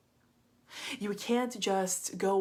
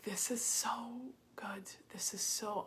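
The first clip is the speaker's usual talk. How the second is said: high, slow, hushed whisper; breathless awed whisper; 4 semitones higher, 5 dB quieter, slower than usual. Retold or imitated. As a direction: whisper it, overwhelmed with gratitude. Woman moved after the reading.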